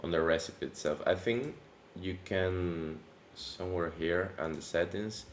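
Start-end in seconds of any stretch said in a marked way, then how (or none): none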